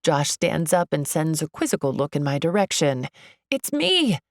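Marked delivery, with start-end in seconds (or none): 3.5-4.2 high, hopeful call; drawn high mild shout. A narrator's reading. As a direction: call across the room to be recognized. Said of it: "I call".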